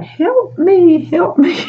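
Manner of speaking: very high squeaky plea; high mock mouse cry; tiny mouse voice. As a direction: play a trapped little mouse. Drawn mice on notes.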